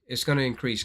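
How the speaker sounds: usual speaking voice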